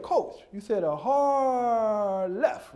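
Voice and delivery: very slow questioning drawl on "hard left"; lisping kid drawing out "hard left"; kid checking instructions. Retold or imitated. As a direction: stretch "hard" right out, then lift "left" as a question. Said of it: "And he said".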